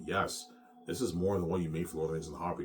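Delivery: normal talking voice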